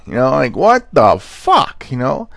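usual speaking voice

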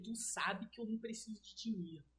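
whispered, imitating the mentor; hushed mentor. Mentor whispering to the crying seller, hushed. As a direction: lean in and breathe it like a secret.

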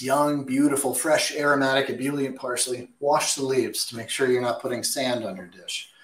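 ordinary talk, nothing marked

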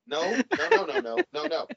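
high, rising string of no's; emphatic refusal. Real, not acted. Refusing four chan, rising nos.

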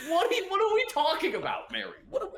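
high, loud disbelieving cry with a laugh; mock outrage at his sister's texts; incredulous.

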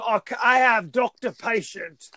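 a light butchered Michael Caine cockney; a hint of Michael Caine's English accent. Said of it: "butchering Michael Caine's accent".

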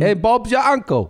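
slow, high mock English accent; bad English accent. Comic throwing slang at co-host, bad high English accent. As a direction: drag it out high in a badly faked English accent. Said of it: "worst at English accent".

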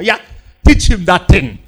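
ordinary talk

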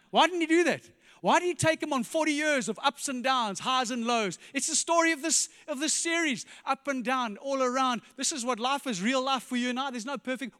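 high, loud and fervent preaching; rising pulpit fervour; preaching at full force. Acted. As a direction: lift the room, pressing each question home.

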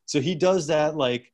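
usual speaking voice

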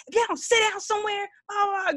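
high, fast mock scolding; imitating mom snapping. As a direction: snap at the kids like mom, phone on mute. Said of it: "when she gets normal, like, she'll snap".

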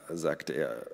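ordinary delivery, nothing marked

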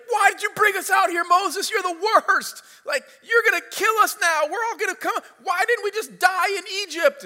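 high, loud hammed-up accusing shout; angry crowd complaining. Preacher voicing Israelites blaming Moses at the Red Sea, high mock shout. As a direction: yell at Moses like a panicked mob. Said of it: "they're like".

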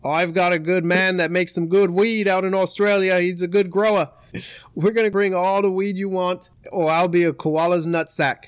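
loud, broad Aussie deal-maker; Australian accent. Acted. Australian surfer making peace with crime lord, broad Australian accent. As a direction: negotiate in a hammed-up Australian accent. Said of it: "the agreement went a little like this".

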